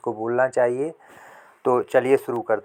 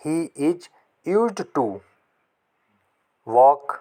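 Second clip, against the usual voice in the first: emphatic model sentence; 2 semitones higher, much slower than usual. Shown as a demonstration. Lift the middle, hit walk hard. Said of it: "उसे टहलने की आदत है".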